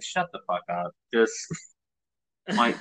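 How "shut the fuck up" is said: softer, under-the-breath comeback; muttered retort. Retold or imitated. Visitor's unspoken reply to patronizing locals, muttered retort. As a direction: say it low to yourself, not to them. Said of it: "And I'm just like".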